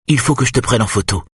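high, quick and lightly excited; giggly exclamation. A narrator's reading. Woman suddenly wanting the doctor's photo, giggly exclamation. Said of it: "s'écria-t-elle en pouffant".

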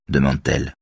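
usual speaking voice

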